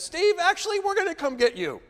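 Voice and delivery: imitating a British guide turning to alarm; British accent. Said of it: "he had kind of a British accent".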